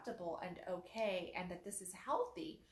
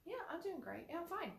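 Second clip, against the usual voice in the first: indifferent "fine"; 8 semitones higher, 5 dB quieter, faster than usual. Shown as a demonstration. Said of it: "indifferent to life right there".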